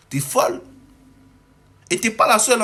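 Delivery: exasperated yelling at a rival creator; angry outburst. Real, not acted. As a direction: let the indignation boil over at her. Ranting at a YouTuber named Ruby.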